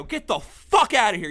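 loud, slow throw-out; angry yell. Retold or imitated. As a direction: order him out slowly and loud. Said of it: "I go".

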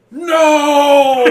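loud mock cry of no; quoted movie shout. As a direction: belt it out like the film line. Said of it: "the best one-liner in the movie is".

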